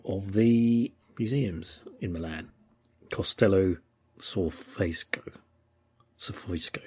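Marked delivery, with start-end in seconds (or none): 3.1-5.3 Costello Sorfesco tried slowly, syllable by syllable; a careful first go at the Italian name; Italian name attempted haltingly; trying the Italian name. Shown as a demonstration. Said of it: "I'll have to get the Italian pronunciation right". 6.2-6.8 Sorfesco breathed voicelessly to himself; whispered try at the name; whispered practice. Shown as a demonstration. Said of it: "It's very hard for an English person to say".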